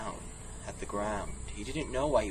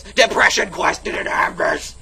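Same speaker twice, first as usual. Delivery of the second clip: loud, high gleeful brag; excited boast; 9 semitones higher, 10 dB louder than usual. Real, not acted. Vlogger brags about jungle audio, loud and excited. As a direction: brag like it's a huge deal.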